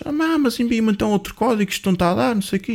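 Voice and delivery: high-pitched imitation of an agitated caller; retold protest in a high, insistent voice. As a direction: imitate him getting worked up, pitched well up. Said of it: "O gajo começa a me levantar a voz".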